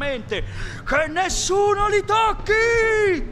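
high, very slow booming order; thundering command. A narrator's reading. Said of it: "tuonò la voce della tigre".

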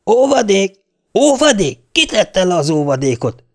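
high, indignant outburst with anger held in; suppressed angry roar. A narrator's reading. O'Reilly learning the prisoner was bailed out, roaring with stifled anger. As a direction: explode on the first words, then rein it in. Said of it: "üvöltötte elfolytva haragját".

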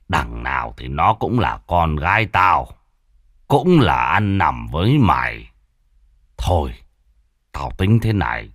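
low, weary resolve after rage; father, calmer, laying down terms to the lover; grudging calm. Acted. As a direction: settle down and lay out terms. Father calms, decides.

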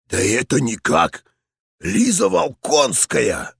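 put-on raspy old general, gladly surprised; delighted. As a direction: greet her with joy. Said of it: "Старый генерал обрадовался".

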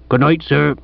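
lightly voiced village policeman; respectful but put-out sergeant's farewell; departing police sergeant. Departing sergeant takes his leave.

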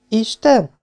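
high-pitched scornful question; mocking young woman's echo, pitched high. A narrator's reading. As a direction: throw the word back in high-voiced disbelief. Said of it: "ismételte a lány gúnyosan".

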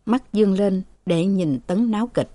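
ordinary delivery, nothing marked

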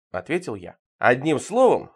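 ordinary delivery, nothing marked

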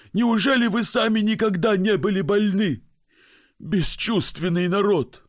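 high, strained, fed-up complaint; exasperated sick man. Acted. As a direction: push the ticket at him in exasperation.

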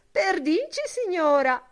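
very high, loud simpering granny; mincing old woman. A narrator's reading. Buck Mulligan playing missus Cale in a high simpering voice. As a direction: squeal it like a prim old lady. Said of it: "parlare smanceroso".

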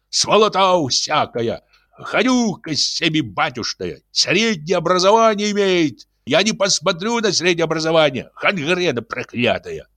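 furious ranting. A narrator's reading. Janitor rails at the locksmith in the yard. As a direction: rant like a drunk janitor. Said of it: "вопил дворник".

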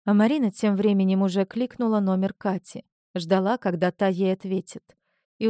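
plain reading voice

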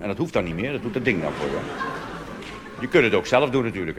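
normal talking voice

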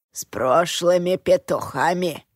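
a hint of a mumbling toothless crone; toothless old woman mumbling. A narrator's reading. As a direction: mumble it with no teeth, lightly. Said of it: "невнятно прошамкала беззубым ртом старуха".

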